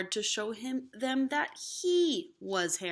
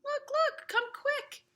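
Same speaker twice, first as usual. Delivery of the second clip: put-on excited children shouting. Children call their parents, high and thrilled. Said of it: "they began shouting".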